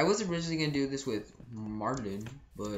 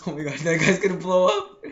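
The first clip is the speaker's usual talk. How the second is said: ordinary talk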